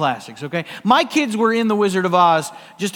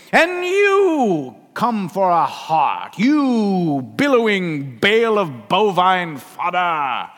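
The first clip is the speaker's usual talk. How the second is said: booming wizard scream; 2 semitones higher, 6 dB louder, much slower than usual. Acted. Wizard berating the Scarecrow, booming scream. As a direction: draw out each insult like a mighty wizard. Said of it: "then he says to the Scarecrow".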